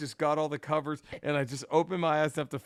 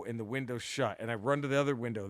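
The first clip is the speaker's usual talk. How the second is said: ordinary talk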